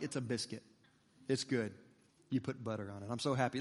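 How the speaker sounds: low, soft, underwhelmed deadpan; deadpan sarcasm. Acted. Customer unimpressed at being asked to review a biscuit, deadpan. Mock reply to review request.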